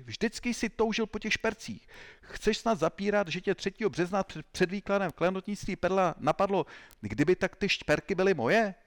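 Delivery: high-pitched, insistent interrogator; roaring accusation. A narrator's reading. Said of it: "zařval agent X tři".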